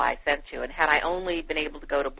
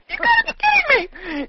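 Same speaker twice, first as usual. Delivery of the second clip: high, loud, shouted disbelief; thrilled shout of surprise; stunned disbelief; 7 dB louder than usual. Retold or imitated. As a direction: shriek it like you can't believe who's calling. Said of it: "I was like".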